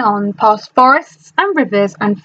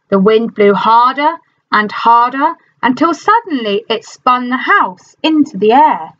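ordinary delivery

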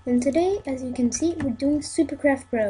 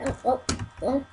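ordinary talk, nothing marked

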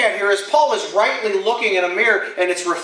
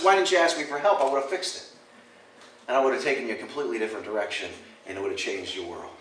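lower, softer fatherly rebuke; gentle voice of God; 7 semitones lower, 7 dB quieter, faster than usual. Pretend. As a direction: speak as God, quiet and kind. God speaking, voiced by preacher.

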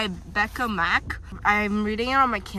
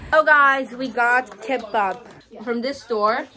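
usual speaking voice

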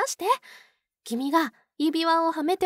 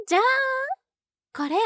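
excited reveal; 8 semitones higher, 3 dB louder, much faster than usual. Acted. Reveals the ring present.

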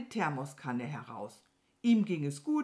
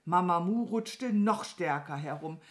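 plain reading voice

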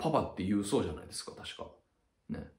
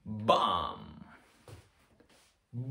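bomb blast sound; 4 dB quieter, much slower than usual. Shown as a demonstration. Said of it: "爆弾爆発した時の音".

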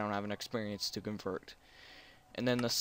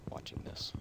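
whisper; 11 dB quieter than usual. Real, not acted. Trails off unvoiced.

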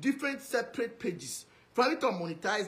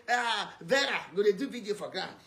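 mock loud calling out; mimicked calling out; 5 dB louder than usual. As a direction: imitate someone hollering at others. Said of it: "when they talk like this".

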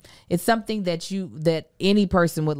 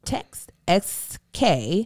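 drawn-out slow letters; very slow ad read; much slower than usual. Acted. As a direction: spell it out very slowly.

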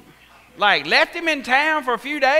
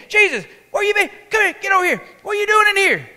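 much higher, fast scolding; scolding parent; 9 semitones higher, 5 dB louder, much faster than usual. Retold or imitated. Parents scolding Jesus for wandering off, high and fast. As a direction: call the boy out, exasperated.

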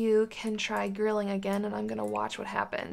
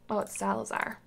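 ordinary talk

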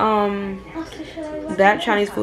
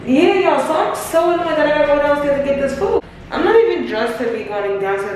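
much higher and louder; loud annoyed complaint; 7 semitones higher, 5 dB louder than usual. Real, not acted. Repeats whispered complaint out loud.

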